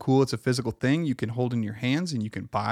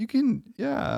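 high mock encouragement; 11 semitones higher, slower than usual.

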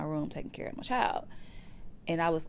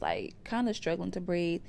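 normal talking voice

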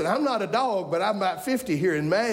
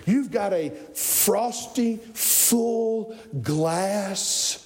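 slow, deliberate, sinking in pitch; slow savouring; much slower than usual. Real, not acted. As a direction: linger on each word like a cool drink. Savours the image of a cold drink.

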